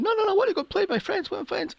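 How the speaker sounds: high, fast mock-child protest; protesting little girl. Little girl being hauled away from her friends, mock pleading.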